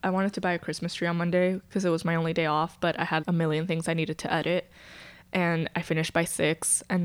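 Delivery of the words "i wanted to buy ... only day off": normal talking voice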